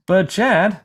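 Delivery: a hint of a puzzled viewer cutting in; imagined viewer asking. A viewer of the tutorial interrupts the teacher, lightly voiced. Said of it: "you may ask yourself".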